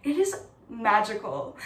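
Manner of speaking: ordinary talk, nothing marked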